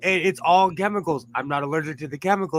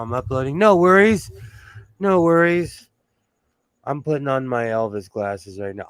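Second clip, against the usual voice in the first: normal talking voice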